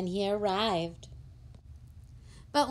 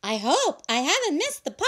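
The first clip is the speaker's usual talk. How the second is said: hurried guest; 6 semitones higher, 4 dB louder, much faster than usual. Acted. Late guest arrives.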